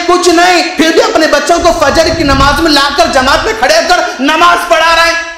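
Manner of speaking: high, ringing sermon delivery; fervent preaching praise. Acted. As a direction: hail these parents from the pulpit. (जोश भरी तारीफ़)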